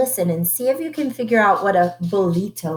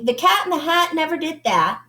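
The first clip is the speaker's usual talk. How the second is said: fast tongue twister; 6 semitones higher, faster than usual. Shown as a demonstration. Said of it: "See how fast you can say it".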